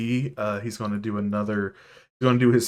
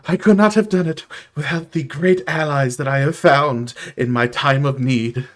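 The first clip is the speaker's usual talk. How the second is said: proud penguin; 4 semitones higher, 6 dB louder than usual. Acted. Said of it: "he is just like".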